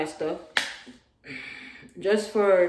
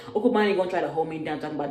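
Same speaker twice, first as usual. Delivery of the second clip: ordinary talk, nothing marked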